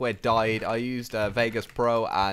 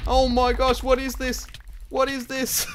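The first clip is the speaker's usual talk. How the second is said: much higher, loud disbelief; shocked; 13 semitones higher, 5 dB louder, slower than usual. Real, not acted. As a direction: shriek at the sudden chaos. Sudden lag chaos in game.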